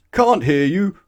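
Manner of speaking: loud, high roared reply. Acted. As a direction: roar it at the little man. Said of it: "roared the milkman".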